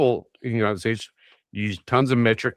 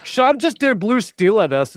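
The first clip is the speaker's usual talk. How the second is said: higher, louder and slower; louder repeat; 10 semitones higher, 4 dB louder than usual. Real, not acted. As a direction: call it out again so they hear. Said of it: "repeated louder to get attention".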